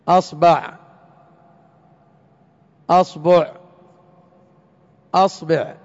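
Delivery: "أصبع" as asbaʕ, asbuʕ, asbeʕ, hamza kept on a; hamza with fatha. Shown as a demonstration. Teacher to class, showing the open hamza with the three b vowels. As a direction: keep the a in "أصبع" and change the b vowel. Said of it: "الهمزة الآن مفتوحة".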